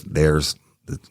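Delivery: usual speaking voice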